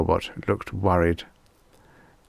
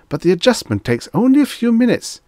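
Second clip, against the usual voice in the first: much higher, louder pleading clerk; pleading salesman; 6 dB louder, faster than usual. Acted. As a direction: beg him to stay. Clerk pleading with leaving customer.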